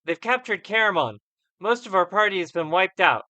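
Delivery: high, loud urgent report; lucid but urgent survivor. Acted. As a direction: tell them the bad news, shaken. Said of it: "he becomes more lucid".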